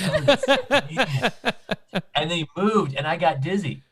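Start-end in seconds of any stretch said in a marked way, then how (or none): 0.1-1.3 laughing, high British imitation; British accent. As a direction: mimic a British accent slowly through laughter. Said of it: "he said in his British accent".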